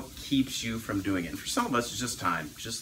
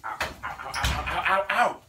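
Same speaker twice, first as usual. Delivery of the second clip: ows of pain; 4 semitones higher than usual. Real, not acted. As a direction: yelp at the hot potato. Grabbing hot sweet potato.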